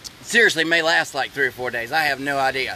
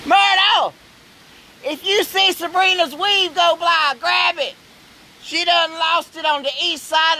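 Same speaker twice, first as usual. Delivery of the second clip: shouting over wind; 6 semitones higher than usual. Real, not acted. Calling out to Myrtle in storm.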